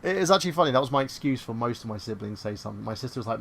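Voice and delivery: ordinary delivery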